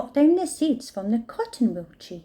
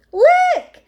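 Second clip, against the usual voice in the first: very high, very loud joyful shout; 10 dB louder than usual. A narrator's reading. Fluffalump shouts after being washed clean, very loud. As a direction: call out in triumph. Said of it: "shouted Fluffalump".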